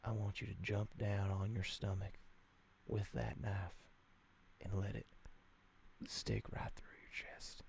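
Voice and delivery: quiet, calm ritual instructions; soft cult leader. Pretend. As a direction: coax him gently toward it. Cult leader gives ritual orders.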